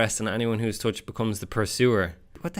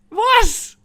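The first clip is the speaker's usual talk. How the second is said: high, loud yelp of disbelief; shocked outburst; 8 dB louder than usual. Real, not acted. Quiz taker stunned by a pronunciation question, shocked yelp. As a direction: squawk it out in astonishment.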